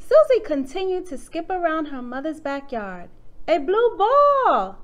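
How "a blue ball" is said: loud, high, drawn-out delight; excited exclamation. A narrator's reading. Susie spots a toy in the backyard, excited. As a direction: stretch it out in happy discovery. Child's delighted find in story.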